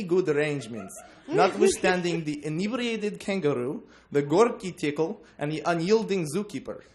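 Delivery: a proud tourist guide in a mock Russian accent. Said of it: "I've been reading the book with a Russian accent in my head".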